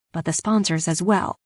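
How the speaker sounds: usual speaking voice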